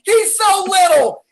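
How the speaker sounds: slow, loud mock line from the film; mock Jon Favreau. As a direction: play it up like a sentimental sidekick. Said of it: "Jon Favreau with his gigantic coat going".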